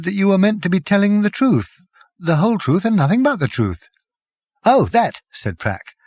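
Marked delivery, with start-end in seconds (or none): 0.0-3.9 high, pointed accusation; indignant. Acted. Arthur confronts Prack. 4.6-5.2 high, loud, dismissive; offhand. Acted. Prack shrugs it off.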